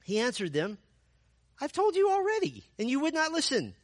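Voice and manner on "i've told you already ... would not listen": much higher, loud exasperated retort; getting mad. Acted. Healed blind man to Pharisees, a high exasperated retort. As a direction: snap back, fed up and sarcastic. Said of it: "you can just see him getting mad".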